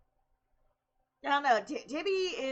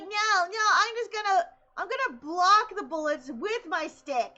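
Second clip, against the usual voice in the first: put-on higher childish protest; imitating kid sister; 6 semitones higher, 4 dB louder than usual. Sister defending the stick, higher kid voice. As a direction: argue back like a little kid. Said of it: "I'm like".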